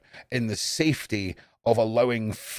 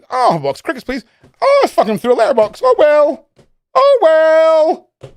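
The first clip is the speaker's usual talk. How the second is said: high, much louder put-on voice; mock shop customer; 10 dB louder than usual. A seller mocking careless customer orders, hammed up loud. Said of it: "She just fucking".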